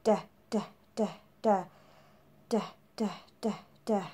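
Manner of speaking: "da" said as short, separate, voiced taps; repeated "da" said crisply like a drum; drum-beat da sounds. Shown as a demonstration. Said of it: "we practice using the drums like this".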